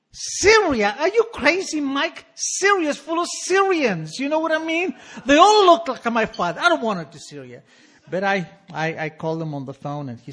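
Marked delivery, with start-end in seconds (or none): 0.1-7.6 high, loud, outraged disbelief; mock panic at going to Syria; panicked protest. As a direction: wail it like the idea is insane. Panicked reply to shrink.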